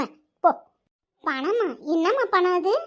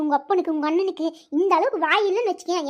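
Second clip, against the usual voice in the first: ordinary talk, nothing marked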